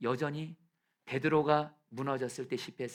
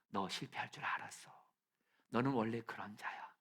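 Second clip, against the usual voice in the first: tender, whispered words to Peter; 6 dB quieter than usual. Pretend. Jesus gently speaking to the failed Peter, whispering. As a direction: whisper it with understanding.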